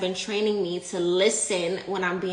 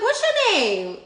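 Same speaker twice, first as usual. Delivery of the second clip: high, bright curious ask; eager question; 12 semitones higher, 3 dB louder than usual. Retold or imitated. Asking the librarian's name.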